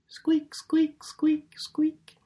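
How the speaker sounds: high, airy squeak, squeak, squeak; donkey squeaks. Pretend. Preacher mimics the donkey Jesus rides on, high airy squeaks. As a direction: squeak like a creaky little donkey.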